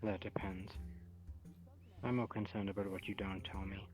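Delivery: ordinary talk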